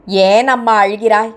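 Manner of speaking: lightly voiced gentle concern for a crying girl; tender question. Old man comforts crying girl.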